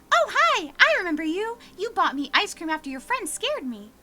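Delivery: put-on high, eager little boy; sugary child, overly helpful; sweet kid voice. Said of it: "he's like".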